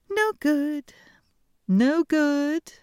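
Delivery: high, loud princess voice; bright haughty princess dismissal; cartoon princess. A narrator's reading. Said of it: "said the princess".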